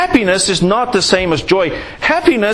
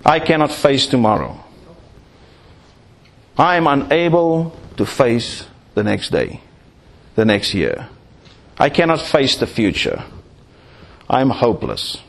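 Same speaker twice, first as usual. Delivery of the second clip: low, subdued voice of despair; acted despondency, broken by long pauses; hopeless; 7 semitones lower, 3 dB quieter than usual. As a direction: sink low, as if giving up. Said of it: "where we say".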